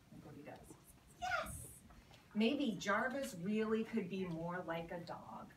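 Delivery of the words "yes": high delighted yes; excited cheer. Retold or imitated. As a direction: celebrate the dog's trick. Owner cheers dog success.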